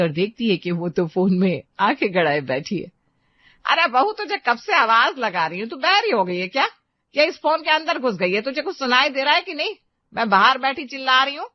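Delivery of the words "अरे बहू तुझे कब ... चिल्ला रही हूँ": put-on nagging older woman, higher, mildly scolding; a cross mother-in-law tells off her daughter-in-law. Mother-in-law scolds daughter-in-law glued to her phone, nagging and higher.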